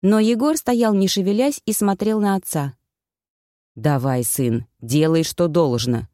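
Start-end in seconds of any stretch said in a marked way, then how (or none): 3.8-6.1 low, grave paternal voice; urging father. A narrator's reading. Old Koschei urges his son on, grave. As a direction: lower the voice and press your son to act. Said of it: "поторопил старый Кощей".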